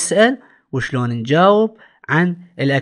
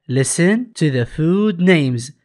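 very slow, clear English instruction; slow instruction reading; much slower than usual. Shown as a demonstration. Teacher reads the workbook instruction slowly to the class. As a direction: read the rubric out slowly.

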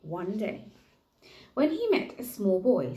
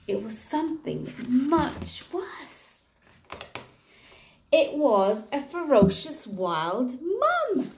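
slow, louder, building suspense; dramatic reveal; 5 semitones higher, 4 dB louder, slower than usual. A narrator's reading. Teacher revealing the ferocious mum to year one, slow and dramatic. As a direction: draw out the twist.

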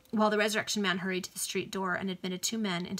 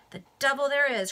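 higher and louder exclamation; ruffian's sharp surprised outburst; startled cry; 6 semitones higher, 6 dB louder than usual. A narrator's reading. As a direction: blurt it out in surprise. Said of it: "cried the Resurrection Man".

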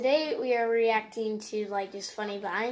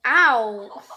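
loud; 11 dB louder than usual. Real, not acted. Reacts to painful fall.

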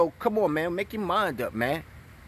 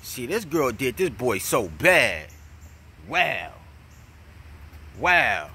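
usual speaking voice

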